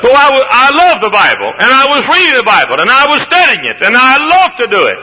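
high ringing preacher's cry; full-force preaching. Acted. Preacher to his congregation, full-force preaching. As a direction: lift it up like a revival preacher.

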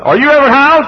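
storyteller doing the nurse, high and bright. Retold or imitated.

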